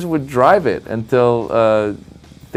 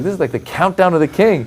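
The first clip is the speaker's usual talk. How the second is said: higher, incredulous aside; nervous amazement; 9 semitones higher than usual. Retold or imitated. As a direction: marvel at the royal countdown. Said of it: "And I was like".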